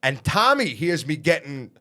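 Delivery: usual speaking voice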